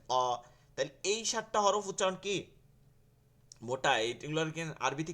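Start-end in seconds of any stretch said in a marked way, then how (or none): 0.1-0.4 "অ" full and rounded; "অ" as a weighty back-of-mouth aw; heavy, thick sound. Shown as a demonstration. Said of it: "ভারী এবং মোটা আওয়াজে হচ্ছে".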